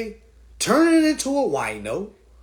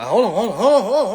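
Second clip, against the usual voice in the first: laughing through the words; chuckling, trying to interrupt; 2 semitones higher, 5 dB louder, faster than usual. Real, not acted. Laughing at the scam accusation.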